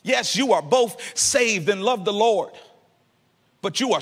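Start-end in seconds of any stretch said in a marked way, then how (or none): none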